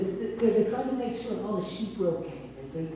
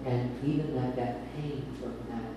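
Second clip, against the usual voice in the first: real emotion, lower and softer; emotional, moved; 9 semitones lower, 8 dB quieter than usual. Real, not acted. Preacher overcome while speaking of the prophet's pain, low and soft. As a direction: speak through being moved to tears. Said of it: "Sorry, I gotta take a second".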